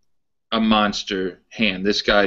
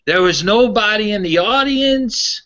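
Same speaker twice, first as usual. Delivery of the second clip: put-on high, louder Pittsburgh old-timer accent; Pittsburgh accent impression; 12 semitones higher, 4 dB louder than usual. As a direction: ham up the Pittsburgh fan's complaint in his accent. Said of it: "I'll try to do his accent".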